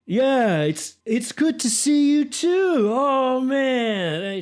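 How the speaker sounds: loud, high-pitched drawn-out greeting; delighted greeting. Acted. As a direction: greet an old friend at full, high volume. Jumpkins returns the happy greeting.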